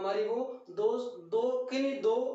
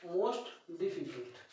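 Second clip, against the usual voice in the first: most difficult spoken very slowly, a little softer and lower; slow model phrase; 4 semitones lower, 4 dB quieter, much slower than usual. Shown as a demonstration. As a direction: ease most difficult out for the class to repeat. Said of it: "teacher models the phrase".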